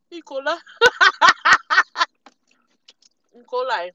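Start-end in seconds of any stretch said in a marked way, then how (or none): 0.6-2.3 shrieking burst of laughter; high loud laugh. Real, not acted. Laughing at herself.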